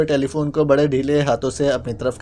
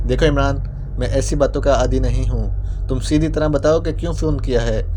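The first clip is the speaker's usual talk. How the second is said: plain reading voice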